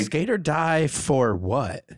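slow, drawn-out delivery at normal volume; spoken slowly, stretching the words; slow slogan. Pretend. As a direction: draw each word out slowly. Tries out a song hook.

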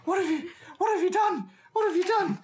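high-pitched, panicky goblin squeals; squealing goblins. Acted. Young goblins crowd round their injured friend, squealing. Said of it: "squealing. They're like".

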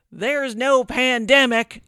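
mock Florida-style accent, slow and drawn out, shouted high; put-on accent. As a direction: ham up a Florida-y local accent, slow and loud. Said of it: "I don't know why I'm giving him that accent".

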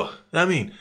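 ordinary talk

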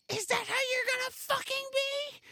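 high-pitched mock mom snapping at her son; mom's snap. Mom snapping at son over ordering water, very high mock screech. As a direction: squeak it out like an angry mom losing it. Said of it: "And Mom's like".

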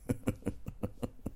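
soft voiceless chuckle; laugh. Real, not acted. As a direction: chuckle quietly after the story. Laughs after telling husband story.

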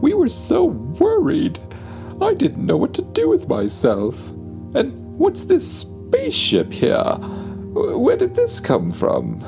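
high-pitched fretting mom voice; worried mother. Acted. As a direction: panic like a flustered mother. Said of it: "they say".

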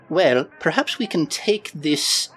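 measured creature voice; Fleeple's character voice. Acted. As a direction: propose a plan to young underlings in character. Character addresses the teenagers.

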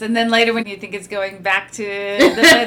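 ordinary talk

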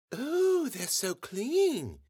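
high, soft, very drawn-out coo; awed little girl. A narrator's reading. Bella gravely considering the pearly teeth, high and awed. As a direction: marvel slowly in a girl's pitch.

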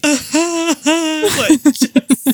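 high, hammed-up sobs breaking into laughter; imitated sobbing. Mother acting out her hallway breakdown, imitated sobbing. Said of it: "sobbing in the hallway".

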